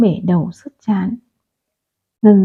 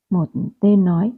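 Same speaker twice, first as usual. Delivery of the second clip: ordinary delivery, nothing marked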